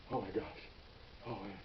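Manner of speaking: very soft, low exhale of words; hushed disbelief. Retold or imitated. Officers driving off after a close call, hushed disbelief. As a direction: let it out quietly, stunned.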